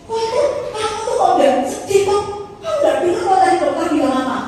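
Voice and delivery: high, loud incredulous questioning; worked-up wife. Retold or imitated. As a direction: act out a wife confronting her husband. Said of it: "Saya kan jadi emosi".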